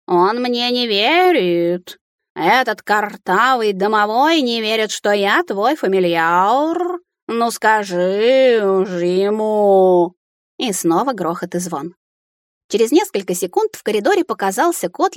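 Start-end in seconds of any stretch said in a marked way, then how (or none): 0.1-10.1 медленная, подвывающая кошачья жалоба; пожалуйся хозяйке, растягивая слова котом; ревущий обиженный кот. A narrator's reading. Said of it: "взревел Филя". (обиженно реветь кошачьим голосом)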